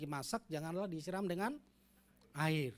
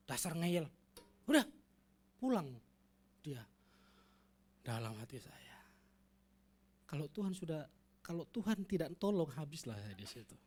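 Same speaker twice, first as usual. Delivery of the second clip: ordinary delivery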